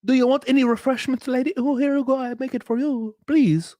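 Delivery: high-pitched, gushing mock servility; high, sweet mock fawning; fawning eager-to-please host. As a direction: imitate everyone fussing over a guest. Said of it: "Everybody was like".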